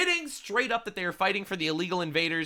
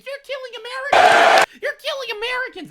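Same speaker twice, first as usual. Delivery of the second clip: very high-pitched mock shriek, bursting loud mid-phrase; mock screaming; 9 dB louder, faster than usual. Host mocking a congresswoman screaming during the speech, shrieked mockingly. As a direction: imitate a hysterical protester screaming in falsetto.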